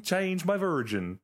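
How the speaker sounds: slow, mock Swedish accent; lightly played Swedish caller; put-on Swedish accent. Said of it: "Why does he sound Swedish as well".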